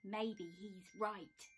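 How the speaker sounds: slow, hesitant hedgehog; drawn out; slow and unsure. Acted. Hedgehog giving in. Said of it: "said Hedgehog".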